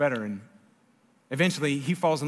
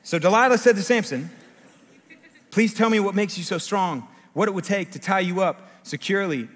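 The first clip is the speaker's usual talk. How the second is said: raised, projected reading voice; scripture reading; 3 semitones higher, 4 dB louder than usual. A narrator's reading. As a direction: announce the verse clearly to the congregation. Reads the Bible aloud.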